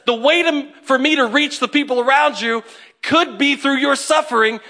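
loud, high and driving sermon; full-force preaching. Acted. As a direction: preach it out to the congregation.

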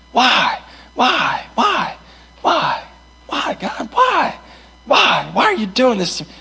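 high, pleading cries of why, pitch leaping; anguished why. Retold or imitated. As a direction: plead desperately upward to God. Acting out crying to God.

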